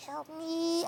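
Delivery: high, hushed strangled squeak; choking voice. Acted. Schoolboy to his friend at the back of class, quiet high choking squeak. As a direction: squeak it softly into a friend's ear. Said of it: "in my friend's ear, I'd go".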